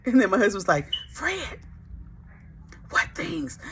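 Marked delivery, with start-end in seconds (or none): 1.1-3.6 high, much softer pleading; hushed eager. Retold or imitated. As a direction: lean in and ask it on the quiet. Husband secretive, high and soft.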